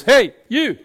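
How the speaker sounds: high, loud Glaswegian call; Glaswegian Jesus. Acted. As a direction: hail someone in broad Glaswegian. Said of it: "Jesus has not got a Glaswegian accent".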